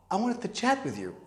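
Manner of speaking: small, soft, hesitant voice; nervous. Retold or imitated. Nervous son phoning his father late at night, timidly. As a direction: say it softly, unsure of the reaction. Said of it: "Then I start sound like we nervous".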